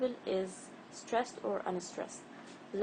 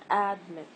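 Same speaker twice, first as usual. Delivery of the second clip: ADmit, AD punched and MIT dropped; stress on first syllable; 5 dB louder than usual. Shown as a demonstration. Teacher offers learners the wrong option, ADmit stressed on the first part. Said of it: "not admit".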